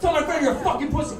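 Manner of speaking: loud, high acted shout; furious order. Dave punches the skinhead and repeats himself, furious shout. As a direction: act out yelling the order in his face.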